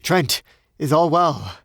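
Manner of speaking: anxious call. Acted. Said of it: "Braith's anxious voice".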